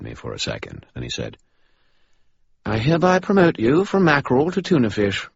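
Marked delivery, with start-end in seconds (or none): 2.7-5.3 Bob voice. Acted. Bob mock-solemn.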